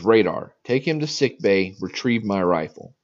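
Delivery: loud drill instructor shout; barked order. Retold or imitated. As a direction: bark it like a drill sergeant. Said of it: "He looks at me and says".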